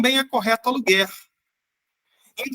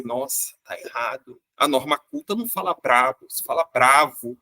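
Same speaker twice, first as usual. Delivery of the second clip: snooty correction; 6 semitones lower than usual. Retold or imitated. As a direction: sniff at the word brabo like a grammar snob. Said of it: "a gente fala assim".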